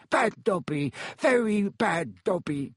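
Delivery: high elf self-scolding; slow, high-pitched elf mumble; muttering house-elf. A narrator's reading. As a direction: scold yourself as a dazed little elf.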